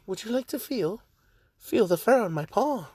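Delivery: put-on high British accent, inviting; Nezu. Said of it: "I don't know why I made him British".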